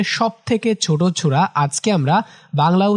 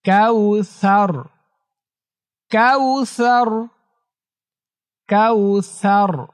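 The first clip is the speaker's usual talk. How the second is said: slow melodic chant, long vowels held; slow tajweed recitation drill; Quran recitation; 4 semitones higher, much slower than usual. Shown as a demonstration. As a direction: chant each phrase for pupils to repeat. Repeat-after-me recitation drill.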